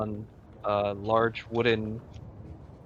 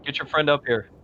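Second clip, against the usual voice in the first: higher, louder captain's order; yelling out; 4 semitones higher, 7 dB louder than usual. Acted. Captain yells out to the party to fetch their diver, raised voice. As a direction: call it out loudly to the party. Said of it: "yells out to you guys".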